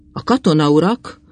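ordinary delivery, nothing marked

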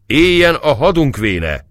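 high, loud toast cry, slow; cheering shout. Acted. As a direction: raise a glass and shout it across the hall. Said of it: "kiáltott Zoltai".

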